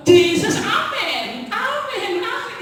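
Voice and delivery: high, hammed-up cries of amen with a laugh; mocking ecstatic congregation. Preacher mocks a wild church crowd with high, laughing amens. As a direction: parody a frenzied congregation. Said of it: "Dan jemaatnya juga".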